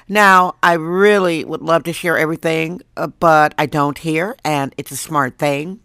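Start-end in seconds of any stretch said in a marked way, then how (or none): none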